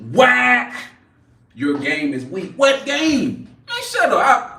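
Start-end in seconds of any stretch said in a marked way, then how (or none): 0.1-0.7 a higher, louder single punched word; emphatic whack. Real, not acted. Dismissing the troll's joke with an emphatic whack. 2.6-4.6 much higher, sharp comeback; high retort. Real, not acted. Snapping back at the troll in chat, high and sharp.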